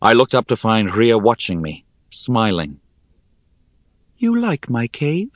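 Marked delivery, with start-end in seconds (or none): 4.2-5.3 high, airy little spider voice; airy small voice. Acted. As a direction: speak tiny and airy, from far up a wall. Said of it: "A new voice, airy and small".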